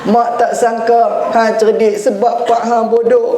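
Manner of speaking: high, mock-tearful sobbing line; tearful punchline. Mother crying over son's results, mock tearful. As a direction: deliver the insult through mock tears. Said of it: "mak pula kata".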